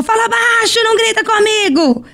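mock scolding, high and sharp; imitating a mother shouting at her child; retold scolding yell. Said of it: "e ela gritou com ele".

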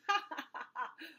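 high ha-ha into ho-ho; acted belly laugh. A narrator's reading. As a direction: guffaw as the tickled elephant. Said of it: "He began to giggle".

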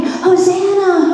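high, slow ringing Hosanna; crowd cheer. Retold or imitated. Crowd greeting the king, high ringing call.

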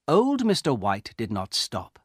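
plain reading voice

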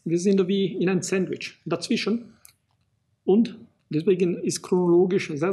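usual speaking voice

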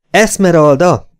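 high, loud, drawn-out call; loud yell. A narrator's reading. Clayton to the fainted Esmeralda, yelling. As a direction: yell the name to rouse someone. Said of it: "üvöltött a fülébe".

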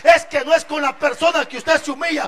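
fuerte y cada vez más alto; predicación a toda voz. Acted. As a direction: sube la fuerza frase a frase. Predicación creciente. (el predicador exalta la humildad de Cristo a gritos)

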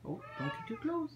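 high squeaky pet voice. Pretend.

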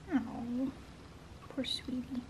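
very soft, near-whispered sympathy; soft pity. Real, not acted. Owner pities her sick dog, very softly. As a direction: murmur it tenderly, almost under the breath.

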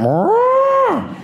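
high, loud mock moo; cow moo. Comedian acting the curious cows at the pond, a loud high moo. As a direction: bellow a silly high moo. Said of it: "Actually, they went".

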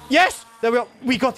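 high, loud burst of triumph; elated yell; triumphant shout. Real, not acted. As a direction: celebrate a long-awaited win. Finally gets the needed item.

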